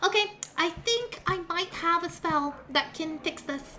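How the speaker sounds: high, cheerful healer voice; bubbly halfling cleric. Acted. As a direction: reassure the patient brightly. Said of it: "looking after you and says".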